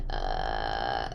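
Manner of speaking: long, very low creaky "uh"; vocal fry. Shown as a demonstration. Demonstrating vocal fry for the host, low creaky noise. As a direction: drop into a drawn-out vocal fry. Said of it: "I'm gonna make the noise".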